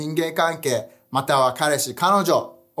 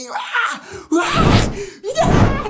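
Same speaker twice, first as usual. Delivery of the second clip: high, loud anguished wailing cries; possessed man's wailing; 3 dB louder, much slower than usual. Retold or imitated. Pastor acting out the possessed man wailing day and night, loud and high. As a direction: howl like a man tormented by demons. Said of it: "昼も夜も大声でわめき".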